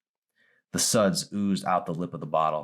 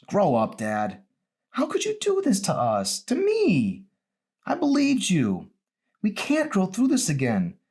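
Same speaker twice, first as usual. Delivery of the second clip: put-on high, loud teenage daughter; angry daughter; 12 semitones higher, 4 dB louder than usual. Daughter lashing out at her drinking father, high and loud. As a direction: hurl it at him, voice pitched way up. Emery voiced in the story.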